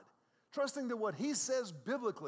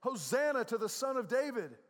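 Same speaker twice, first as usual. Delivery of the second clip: loud, raised cry of acclaim; crowd shouting; 5 semitones higher, 6 dB louder than usual. Acted. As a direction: read it out like a cheering crowd. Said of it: "those that followed shouted".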